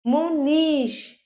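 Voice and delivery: मुनीश said slowly, nee held long; long ई sound. Shown as a demonstration. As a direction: stretch the ee in मुनीश. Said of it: "बड़ी ई". (मुनीश में नी को लंबा खींचें)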